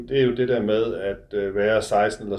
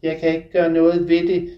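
higher, louder girlish voice mocking the son; mother's mocking, girly parody of her son; 7 semitones higher, 7 dB louder than usual. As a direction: mimic him like a silly girl. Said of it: "med tøset stemme som en parodi på min".

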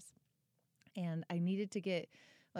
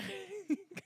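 high laugh; 12 semitones higher than usual. Real, not acted. Laughing at own story.

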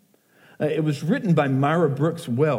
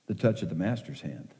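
hushed reverent; 8 semitones lower, 9 dB quieter, faster than usual. Acted. Poem's key line, emotional.

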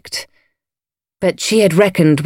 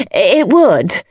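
eager little confirmation; fervent agreement; 3 semitones higher than usual. A narrator's reading. Lady Coote agreeing with her gardener, fervently. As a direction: agree readily. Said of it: "wondered why she agreed so fervently".

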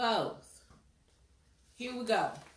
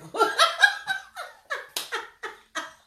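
loud high laugh; 8 dB louder than usual. Real, not acted. Big laugh at the joke.

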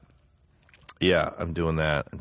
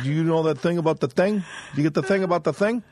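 pitched-up mock Italian-American, quicker on the second line; proper wiseguy voice; 9 semitones higher than usual. Teller showing the right way to deliver the mob line. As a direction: show how a Goodfella should say it. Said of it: "you're supposed to go".

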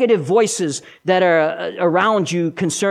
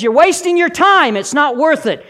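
high, louder nay-saying voice; critical first voice; 8 semitones higher, 5 dB louder than usual. Acted. Critic tells the believer to give up, high and insistent. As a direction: push it high, like a discouraging critic. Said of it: "The first voice says".

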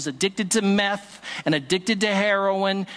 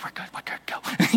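whisper; 3 dB quieter than usual. Retold or imitated. Waving off worried administrators mid-prayer.

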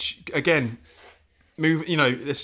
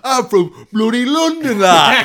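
higher, louder, slow hammed-up London accent; mock London accent; 10 semitones higher, 10 dB louder, slower than usual. Daphne's brother claims London roots in mock London accent. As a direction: play a brother bragging in a cartoon London accent. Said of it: "they'll be like".

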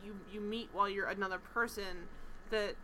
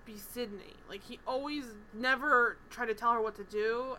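usual speaking voice

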